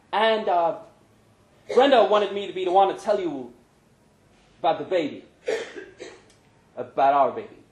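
lightly voiced uneasy friend; nervous Pancho. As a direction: break the news nervously. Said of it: "He looked uncomfortable, nervous".

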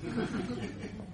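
high breathy cackle; little mad-scientist laugh; soft old woman cackle. Acted.

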